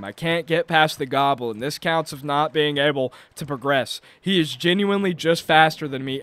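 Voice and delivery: ordinary talk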